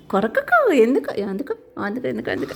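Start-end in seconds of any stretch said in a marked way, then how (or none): 0.1-0.7 startled squeal. Real, not acted. Bird bites, she squeals.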